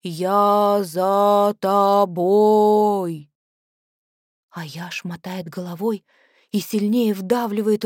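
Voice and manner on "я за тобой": whistling s. Acted. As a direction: drag the words like a forgotten tongue. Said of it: "Голос низкий, с присвистом". (медленный нечеловеческий голос с присвистом)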